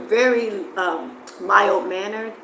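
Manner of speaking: ordinary delivery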